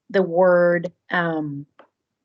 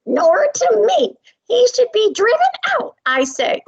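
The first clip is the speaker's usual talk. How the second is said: high character voice; 12 semitones higher than usual. Acted. Teacher voicing a bird, demanding the duckling leave. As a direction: pitch way up and demand he go.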